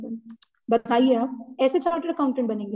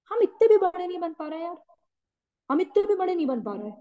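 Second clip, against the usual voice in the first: higher-pitched exasperated mock plea; higher, mildly hammed-up lament; mock helpless complaint; 7 semitones higher than usual. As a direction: complain like a dependent, helpless child. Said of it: "हम रो रहे हैं".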